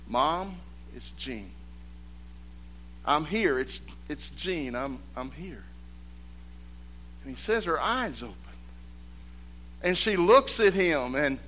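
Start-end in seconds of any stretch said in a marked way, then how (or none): 0.1-5.6 soft, hushed words to a dying mother; retold whisper. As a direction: lean in and speak softly, not a true whisper. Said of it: "he whispers in her ear".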